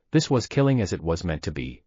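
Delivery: ordinary delivery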